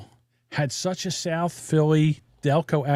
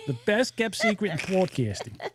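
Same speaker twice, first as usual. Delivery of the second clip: higher, exaggerated Delco accent; leaned-into Delco accent; 4 semitones higher, 3 dB quieter than usual. Host embracing his accent for the show tagline, thick Delco. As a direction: lean hard into a South Jersey Delco accent. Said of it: "just gonna just lean into it".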